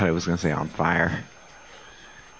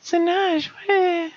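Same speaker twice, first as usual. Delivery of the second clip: put-on falsetto young girl, a little louder; high girl voice; 4 dB louder, much slower than usual. Voicing the girl character while playing, high falsetto. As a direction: squeak the name out like a young girl. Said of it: "I'll be able to do, like, voices".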